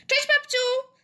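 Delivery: put-on high, bright-hearted child's call. A narrator's reading. Girl bursting in to greet grandma, calling out. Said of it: "zawołała".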